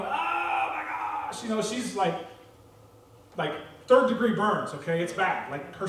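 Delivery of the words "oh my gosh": higher, drawn-out mock moan of pain; imitated pained wail. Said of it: "writhing in pain, going".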